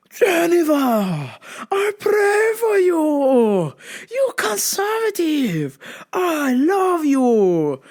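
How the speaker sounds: high, drawn-out mock-tearful wail; Shits Romero. Dad as fighter praying for child, hammed-up sobbing cry. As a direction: howl each phrase very slowly. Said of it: "he's like Shits Romero from the U F C".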